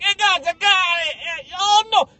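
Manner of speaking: high, loud mock Italian player; overeager Italian player. Italian guy sticking his foot out on a hilltop, overeager yell. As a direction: call for the ball, then mock panic.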